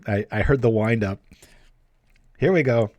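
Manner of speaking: usual speaking voice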